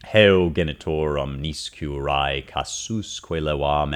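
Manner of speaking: slow, heavy Latin recitation; slow dirge-like Latin. Shown as a demonstration. Teacher recites the Latin line, slow and dirge-like. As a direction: drag each syllable like a dirge. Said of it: "the meter gets very slow and dirge-like here".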